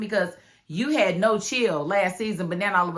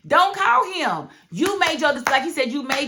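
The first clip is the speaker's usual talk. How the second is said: loud scolding; 7 semitones higher, 5 dB louder than usual. Real, not acted. Scolding Lily directly.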